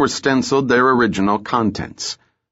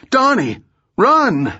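very high cry of warning; urgent yell; 13 semitones higher, 3 dB louder, slower than usual. A narrator's reading. Old woman urges Donnie to flee, urgent high yell. Mother yells warning to fleeing son.

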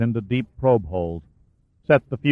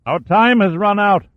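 high, raised call down into a pit; calling down a shaft; 9 semitones higher, 5 dB louder than usual. Acted. Shaw calling down the shaft to Caldweiler, raised voice. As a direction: project it down the shaft to a man far below. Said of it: "Shaw's voice reverberated down the shaft".

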